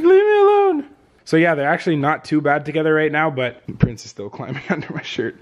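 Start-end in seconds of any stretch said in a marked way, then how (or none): 0.0-0.8 very high, loud squeaky protest; a tiny overwhelmed puppy squeals to be left alone. Pretend. Vlogger voices the overwhelmed puppy, squeaky and high.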